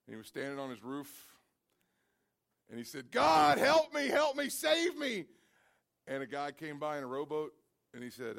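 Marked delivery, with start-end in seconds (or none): 3.1-5.3 high, loud, drawn-out cry for help; desperate plea to God. Acted. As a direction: beg God to save you from the flood. Man on roof begs God.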